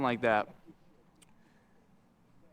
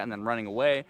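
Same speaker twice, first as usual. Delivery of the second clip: ordinary talk, nothing marked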